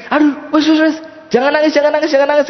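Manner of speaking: high-pitched, flustered hushing; flustered consoling. Acted. Buyer hushes the crying weaver, flustered consoling. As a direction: hush someone crying in a high, urgent voice. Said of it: "segen. ... katanya".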